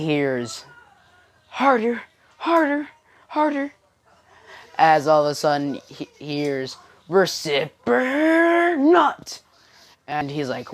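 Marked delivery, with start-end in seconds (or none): 1.5-3.7 high put-on voice, much above usual; overheard cries. Izuku overhears this at Uraraka's dorm door, high-voiced cries. 7.1-9.4 high, loud, drawn-out yell; overheard outcry. Acted. Izuku hears this through the dorm door, high outcry.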